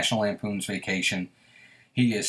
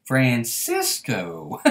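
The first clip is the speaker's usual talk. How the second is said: imitating Elf's call; 5 dB quieter, much slower than usual. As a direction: stretch each Francisco out slowly and quietly, like the movie. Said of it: "And when he goes".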